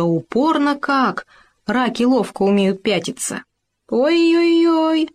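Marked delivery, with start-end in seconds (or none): 3.9-5.1 long high howl of pain. A narrator's reading. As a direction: stretch the wail out high. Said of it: "снова взвыл Хома". (протяжный высокий вой)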